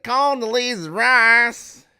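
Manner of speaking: very high, loud, slow drawl; Dave Chappelle character. Retold or imitated. Repeating a favorite skit line, high loud drawl. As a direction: stretch it out in a hammy Southern holler. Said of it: "I love how he says".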